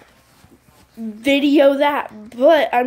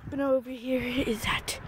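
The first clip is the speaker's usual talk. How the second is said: whispering; 9 dB quieter than usual. Real, not acted. Whispered aside.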